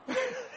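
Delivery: high squealing laugh; delighted high laugh; burst of high laughter. Real, not acted. Pastor cracks up at story.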